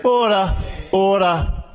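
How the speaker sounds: shouting order order. Real, not acted. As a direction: call the chamber to order sharply. Speaker calling the House to order.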